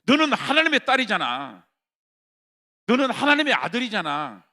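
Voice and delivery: loud, high sermon cry; fervent shouted preaching; full-force preaching. Acted. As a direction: preach it hard to the congregation.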